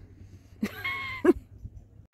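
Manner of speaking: high squealing nervous laugh. Real, not acted. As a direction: squeal at the drop below. Laughs after saying it's a long way down.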